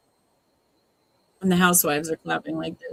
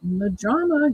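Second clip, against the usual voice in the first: normal talking voice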